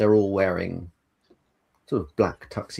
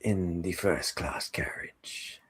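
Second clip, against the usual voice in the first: hushed train host; 5 dB quieter than usual. Acted. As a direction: guide them on gently, trailing into near-whisper. Game master plays train character, hushed.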